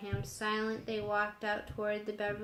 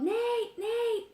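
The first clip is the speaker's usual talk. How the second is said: high, loud urgent call up the stairs; 13 semitones higher, 5 dB louder than usual. A narrator's reading. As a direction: yell his name from below. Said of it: "David Martin called".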